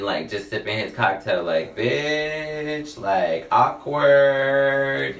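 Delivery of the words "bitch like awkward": awkward onlooker. Retold or imitated. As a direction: stretch it out like an uneasy bystander. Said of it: "he kinda even was looking".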